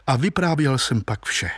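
ordinary delivery, nothing marked

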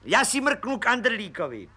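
high, eager voice of a cheeky little policeman; high cheeky detective voice; grinning Pištoras. A narrator's reading. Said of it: "Pan Pištora".

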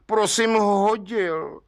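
put-on a contrite young bricklayer confessing to the judge. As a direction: own up slowly and sheepishly. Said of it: "řekl provinilec zkroušeně".

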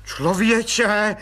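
gasping cry of relief; breathless cry. Acted. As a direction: blurt it out without breath. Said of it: "vykřikl plukovník bez dechu".

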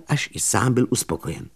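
usual speaking voice

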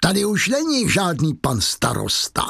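high-pitched, mocking proclamation; terrible scornful triumph. A narrator's reading. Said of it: "Javert se zasmál strašným smíchem".

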